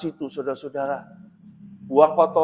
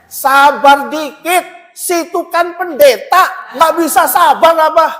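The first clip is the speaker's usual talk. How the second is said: high, slow, louder scolding; scolding old woman; 9 semitones higher, 8 dB louder, slower than usual. Retold or imitated. Grandma scolding the pastor to be patient, high mock scold. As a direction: talk down to him like a cranky grandma. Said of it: "Dia bilang gini".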